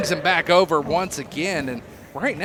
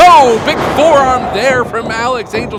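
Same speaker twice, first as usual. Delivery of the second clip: big loud excited call; 6 semitones higher, 10 dB louder than usual. Real, not acted. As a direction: sell the big hit.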